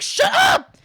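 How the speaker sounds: very high, loud screech; re-enacted furious scream; retold scream. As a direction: shriek it in exasperation. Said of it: "I just, like, screamed at them".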